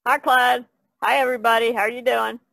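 normal talking voice

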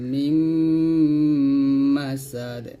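slow tajweed, long hummed m sounds; Quran recitation. Shown as a demonstration. Teacher models the verse for learners, slow recitation. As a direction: hold vowels and nasal hums, bounce the final d.

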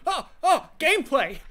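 high, loud eager gasp; excited. Retold or imitated. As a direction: retell your own sudden hope. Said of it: "I got excited for a second".

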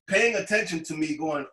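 usual speaking voice

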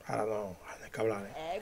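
very soft, low and quick; hushed. Real, not acted. Dubber saying there should be no talking now, hushed. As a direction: keep it under the breath. Hushing himself while waiting.

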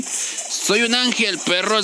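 very slow, drawn-out mock-angelic line; slow dramatic catchphrase. Streamer to his viewers, very slow mock-heavenly boast. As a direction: stretch every syllable proudly.

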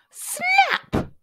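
high-pitched jaws-snapping word; startling storybook snap; sudden snap. A narrator's reading.

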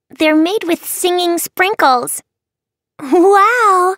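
put-on high, delighted child voice; bubbly little girl wonder; excited girl. Girls about singing sprinkles.

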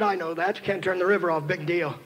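low, soft dismissive retort; smart-aleck father. Retold or imitated. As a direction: mutter it like a know-it-all dad. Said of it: "I'm a smart aleck father".

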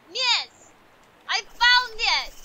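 loud, high, drawn-out declaration; triumphant mock proclamation, stretched out; emphatic announcement. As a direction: proclaim it slowly at full volume. Repeats it emphatically in game role.

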